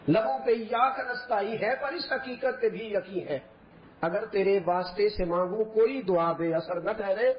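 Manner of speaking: slow, soft verse recitation; poetry within the sermon; recited poem. Real, not acted. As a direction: recite the couplet devotionally. Preacher recites devotional verse.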